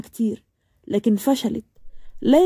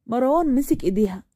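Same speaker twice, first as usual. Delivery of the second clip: ordinary delivery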